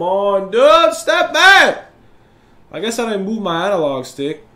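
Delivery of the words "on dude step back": high, loud exasperated yell; frustrated shout. Real, not acted. Player urging his shooter on, frustrated shout. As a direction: yell it at the game.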